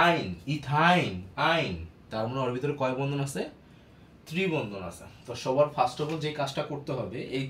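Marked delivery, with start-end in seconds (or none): 0.1-1.7 ethyne said very slowly, ending আইন drawn out; slow drill. Shown as a demonstration. Teacher drawing out the ending for students, slowly. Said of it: "ইন মানে তুমি এটা শুনেই".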